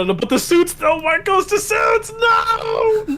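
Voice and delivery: high-pitched wailing objection; real heated fan outrage. Real, not acted. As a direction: cry out in dismay, rising to a drawn-out no. Heated friendly argument over suits.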